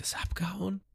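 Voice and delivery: high, soft whispered question. Retold or imitated. Ruffy scans the sea for Arlong, whispering. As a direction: breathe the question while peering into the water.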